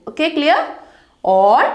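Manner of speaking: usual speaking voice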